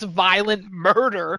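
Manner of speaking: ordinary delivery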